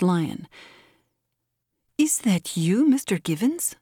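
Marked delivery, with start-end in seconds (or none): 2.0-3.8 lightly voiced sugary sweetness; deliberate saccharine contralto. A narrator's reading. Josefa to Givens after shooting the lion, lightly saccharine. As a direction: ask it with measured, honeyed politeness. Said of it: "in her deliberate, saccharine contralto".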